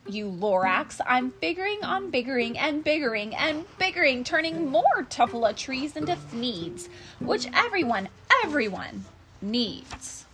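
loud storybook rant easing off; acted mad outburst; angry yell. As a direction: tell someone off, defiant and emphatic. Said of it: "I yelled at the Lorax".

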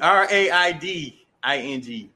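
slow, letter by letter; spelling it out. Shown as a demonstration. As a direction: spell R A I D I N G out slowly. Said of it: "That's the correct word".

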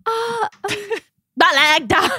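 high, hesitant fake Jamaican accent; bad Jamaican accent. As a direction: stumble into a botched Jamaican accent. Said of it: "Do a Jamaican accent".

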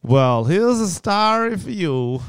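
a Southern-tinged American reading of the drill; American accent. Shown as a demonstration. Reading the drill text to his co-host in an American accent. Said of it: "I gotta do an American accent".

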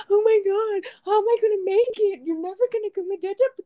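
high, loud hammed-up wail; mock panicked spouse. Wife mimicking a dramatic spouse at deployment, hammed-up wail. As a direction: overplay the desperate clingy spouse, high. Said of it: "I'm not gonna unload on my spouse and be like".

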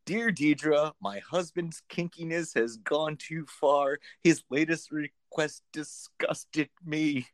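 slow mock-serious reading of a reader's letter; slow dramatic reading. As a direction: read it out slowly like a juicy letter. Reading a tabloid letter aloud.

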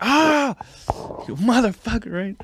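high, indignant yelp and curse; mock pained cry. Boxer cursing his sparring partner after a punch over the top, mock pained. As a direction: act out getting hit, pitched well up.

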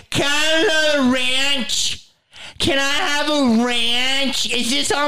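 put-on high, slow whiny girl voice; mock the entitled drunk girl. Ranting about a girl in a pizza shop, high whiny mock voice. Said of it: "She's like".

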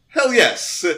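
loud, high cheer; excited shout. Retold or imitated. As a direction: cheer at the movie screen. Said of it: "I was like".

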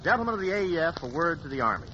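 ordinary delivery

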